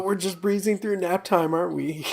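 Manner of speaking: high, lightly voiced sitcom quote; a hint of Buster; quoting Buster. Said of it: "That's a line from Arrested Development".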